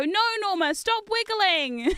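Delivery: hammed-up scolding of a wiggly plush; mock yell. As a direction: scold the toy with a half-laugh. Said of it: "yell at me".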